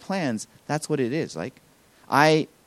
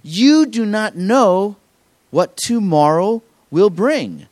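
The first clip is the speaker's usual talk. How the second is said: very slow, louder and higher, every word weighed; preached scripture; 6 semitones higher, 7 dB louder, much slower than usual. Acted. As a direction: hammer home each word slowly. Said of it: "And he says".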